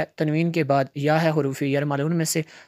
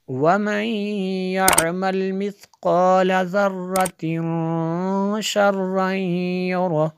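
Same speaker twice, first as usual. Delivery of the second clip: slow, melodic tajweed recitation with every rule observed; Quran recitation; 4 semitones higher, 4 dB louder, much slower than usual. Acted. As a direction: recite the surah in a measured, flowing chant. Full surah recitation. (तजवीद के साथ धीमी, सुरीली तिलावत)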